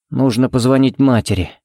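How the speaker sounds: usual speaking voice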